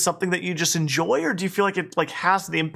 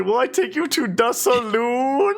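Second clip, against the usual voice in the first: drawn-out, higher Southern drawl; hammed-up Southern cowboy invitation; Southern accent; 5 semitones higher, slower than usual. Streamer showing off a Southern accent to chat, hammed-up Southern drawl. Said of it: "I have an amazing Southern accent".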